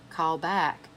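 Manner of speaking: ordinary delivery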